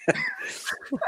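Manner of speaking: high, breaking-up laugh; real laugh. Real, not acted. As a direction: burst out laughing at your own tease. Laughs at own teasing.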